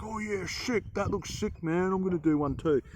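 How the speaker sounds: high-pitched put-on enthusiasm; hammed-up admiring voice, pitched well up; mock impressed onlooker. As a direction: play an easily impressed mate at the campfire. Imagined admirers round the campfire.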